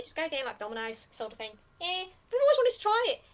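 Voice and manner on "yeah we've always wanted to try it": high, loud, quick chime-in; eager bandit. Acted. Bandit admits a long-held wish to adventurers, eager. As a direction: jump in excitedly, voice pitched up.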